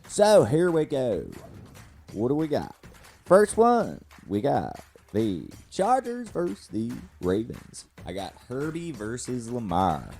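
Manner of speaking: high, loud, exaggerated cartoon coach. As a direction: bounce every line like a cartoon coach. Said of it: "Every week I do a little old coach".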